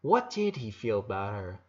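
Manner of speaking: ordinary delivery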